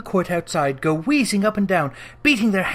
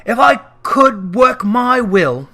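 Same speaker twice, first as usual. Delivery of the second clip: louder, slow indignant threat; indignantly; 4 semitones higher, 7 dB louder, slower than usual. A narrator's reading. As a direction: lean hard into each word. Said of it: "said Scrooge indignantly".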